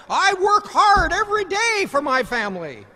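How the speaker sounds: high, loud scolding father; a dad snapping at his son; angry father. Acted. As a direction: bark it at the child, fed up. Father scolding son about money.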